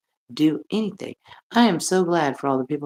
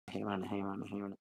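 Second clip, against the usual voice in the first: low, quiet grumbling Muppet grouch; a low, quiet Sesame Street grouch nagging Rhonda; 9 semitones lower, 16 dB quieter, faster than usual. Retold or imitated. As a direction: growl her name low like a grumpy puppet. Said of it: "You remind me of somebody on Sesame Street".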